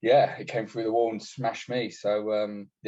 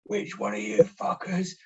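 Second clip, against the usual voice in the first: mock furious sergeant, higher and louder, slower; imitating an angry bark; 9 semitones higher, 4 dB louder, slower than usual. Mate demanding who compromised the ambush, mock angry bark. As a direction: retell him tearing into the blokes, higher and slow. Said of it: "some mate just like".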